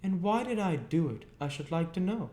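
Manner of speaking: high, indignant demand; high-pitched exasperated sister; exclaiming sister. A narrator's reading. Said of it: "exclaimed my sister".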